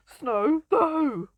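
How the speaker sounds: sudden loud shocked yelp; surprised cry. Real, not acted. Player spotting snow, loud shocked cry. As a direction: blurt it out in loud dismay.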